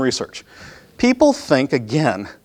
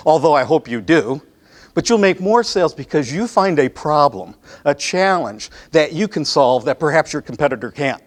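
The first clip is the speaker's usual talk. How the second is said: normal talking voice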